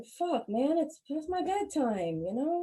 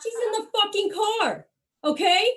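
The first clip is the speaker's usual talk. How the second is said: indignant rant; 8 semitones higher, 4 dB louder than usual. Acted. Ranting at nosy classmates.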